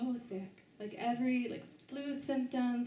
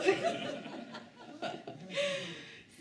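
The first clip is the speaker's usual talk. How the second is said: high giggle. Real, not acted. Laughing with audience.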